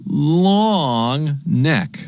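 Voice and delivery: long held and drawn out, playfully. Shown as a demonstration. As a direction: stretch the word long way out. Word stretched to picture flamingo's neck.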